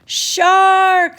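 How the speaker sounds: high, loud panicked scream. A narrator's reading. Beachgoer warning everyone of a shark, screaming. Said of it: "somebody suddenly screamed".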